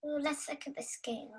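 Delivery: ordinary talk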